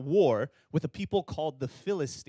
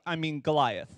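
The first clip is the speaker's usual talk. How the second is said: ordinary talk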